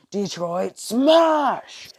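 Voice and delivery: hugely drawn-out battle cry; superhero's signature attack shout; All Might. Acted. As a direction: call the attack out high and stretched. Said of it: "All Might s-, is seeing".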